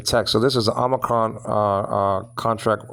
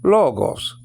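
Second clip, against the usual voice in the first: Logos with LO lifted in pitch, then falling; raised first syllable; 3 semitones higher than usual. Shown as a demonstration. Teacher showing the acute accent, Logos raised on LO. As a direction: go up a little on the LO of Logos. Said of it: "telling you to go up a little bit".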